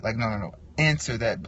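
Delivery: ordinary talk, nothing marked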